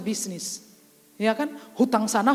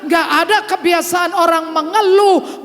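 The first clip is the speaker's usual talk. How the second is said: loud, slow, high preaching voice; fervent preaching; 7 semitones higher, 8 dB louder than usual. Acted. Preacher booming slowly to the congregation, fervent.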